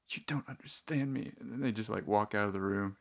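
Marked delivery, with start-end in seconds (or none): 0.1-1.3 breathy whispered TV-drama line, higher; hushed melodrama parody; mock dramatic whisper. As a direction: whisper it like a wounded character walking out. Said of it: "someone will be like".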